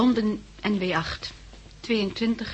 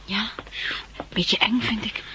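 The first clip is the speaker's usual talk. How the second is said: whispered aside; 5 dB quieter than usual. Acted. Uneasy aside about the organisation.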